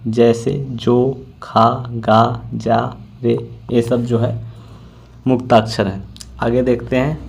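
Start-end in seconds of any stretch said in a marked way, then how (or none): 0.1-2.4 drawn-out vowel endings on जो, खा, गा; open syllable examples. Shown as a demonstration. Teacher demonstrating open syllables to students, slowly stretched. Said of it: "मुक्ताक्षर की अंतिम ध्वनि स्वर होती है".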